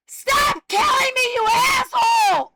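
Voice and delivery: very high, loud crying scream; desperate scream. Real, not acted. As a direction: shriek it out in real anguish. Screaming at harassers.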